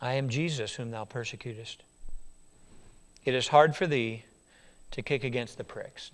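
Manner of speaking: low, soft, solemn voice; voice of Jesus. Acted. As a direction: speak it low and hushed. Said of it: "And the Lord said".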